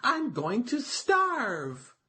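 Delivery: high, slow little turtle moaning; a worried turtle dragging out starve; wailing turtle. A narrator's reading.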